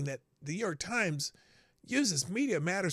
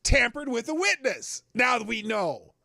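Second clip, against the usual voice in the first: louder and higher, slow and hammering; raised indignant declaration; worked-up emphatic rant; 7 semitones higher, 6 dB louder than usual. Real, not acted. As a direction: hammer home each point, indignant. Host repeating claim, worked up.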